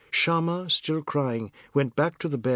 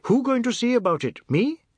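high, loud clowning question; comic mock incredulity; light buffoonery; 12 semitones higher, 5 dB louder than usual. A narrator's reading. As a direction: play the fool, voice leaping up. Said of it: "role of the licensed buffoon".

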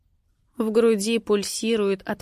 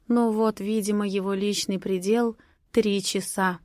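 ordinary delivery

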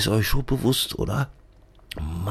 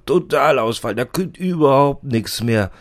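higher and louder imitation of the boss grumbling; loud mock complaint; 5 semitones higher, 5 dB louder than usual. Assistant imitating the boss's complaint, louder. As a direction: blurt it out like the fed-up boss. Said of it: "sagt er immer".

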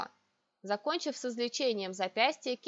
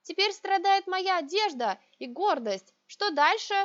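indignant; 8 semitones higher, 5 dB louder than usual. A narrator's reading. Heroine protests to emperor.

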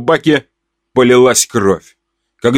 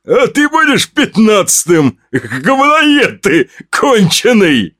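much higher, slow shouted threat; menacing maniac; 9 semitones higher, slower than usual. Acted. Axe maniac threatening the narrator, gloating shout. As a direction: shout it slowly, relishing it.

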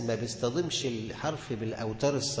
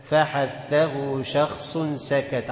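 louder, stretched recital of the mnemonic; loud slow recitation; 2 semitones higher, 6 dB louder, much slower than usual. Shown as a demonstration. Teacher chanting the mnemonic loudly for students. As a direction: chant it slowly and firmly. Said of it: "teacher recites mnemonic louder".

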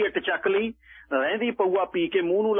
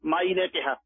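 ordinary delivery, nothing marked

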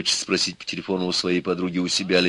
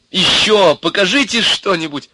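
put-on high, loud girlish request; eager pleading; 12 semitones higher, 6 dB louder than usual. A narrator's reading. Milka wanting more of Ivan's show, eager. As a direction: coax him into showing more. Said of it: "попросила она".

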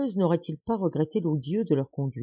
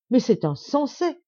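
high, loud and slow, aghast; shocked outcry; 7 semitones higher, 4 dB louder than usual. A narrator's reading. The baron, stunned by the peddler plan, cries out aghast. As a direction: cry out in disbelief, drawing it out. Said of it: "s'écria monsieur d'Escorval".

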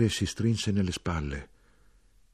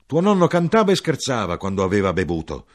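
exasperated mother; 3 semitones higher, 8 dB louder than usual. Acted. Mother keeps complaining.

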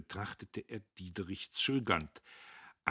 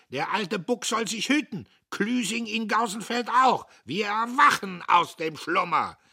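blustering threats; 13 semitones higher, 11 dB louder than usual. Acted. Dietrich boasts with threats.

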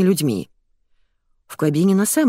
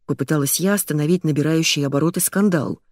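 ordinary delivery

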